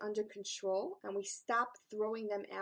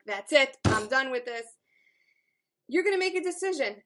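loud, determined declaration; fired-up resolve; 3 semitones higher, 8 dB louder than usual. Retold or imitated. Coach voicing a fired-up listener, loud and resolute. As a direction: slam the door on the habit, loudly. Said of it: "fired up with a passion of".

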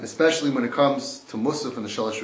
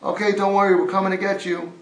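loud, higher call down a pit; 6 semitones higher, 7 dB louder than usual. Acted. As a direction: shout reassurance down to him. Calling down into a pit.

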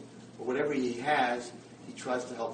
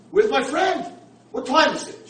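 much higher and louder, urgent; alarmed demand; 14 semitones higher, 14 dB louder than usual. Retold or imitated. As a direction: demand an answer in panic. Blind man demanding answers.

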